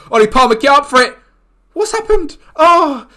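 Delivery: loud, high urging shout; excited yell. Real, not acted. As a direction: yell at the striker to rise for it. Urging striker at the cross.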